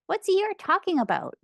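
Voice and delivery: ordinary delivery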